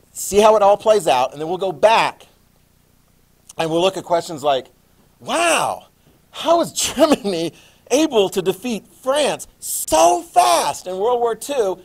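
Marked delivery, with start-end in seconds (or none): 5.2-11.8 mock-amazed, rising high; wide-eyed classroom wonder; amazed question. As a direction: play up the wonder of it. Teacher voicing amazed question.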